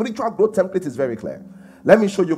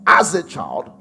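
slow scripture recitation; 4 dB louder, slower than usual. Acted.

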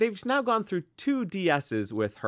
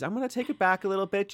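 ordinary talk

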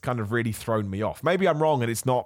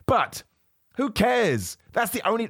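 yelling; 11 semitones higher, slower than usual. Real, not acted. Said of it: "I'm yelling with you".

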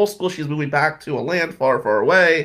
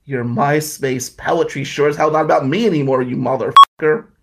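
louder and louder, swearing at her at the end; angry rant; 6 dB louder than usual. Retold or imitated. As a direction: spit it out, building to a yell. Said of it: "And I'm like".

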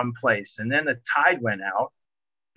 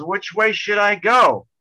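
mock yell from the back of the boat, slowed; dad yelling back; 7 semitones higher, 5 dB louder, slower than usual. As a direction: call it back louder, a bit higher. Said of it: "my dad yelled back".

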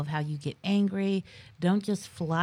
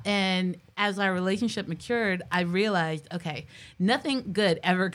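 ordinary talk

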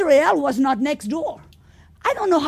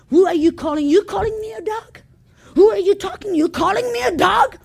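higher, louder mock outrage; 4 semitones higher, 4 dB louder than usual. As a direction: ham up an insulted believer ready to fight.

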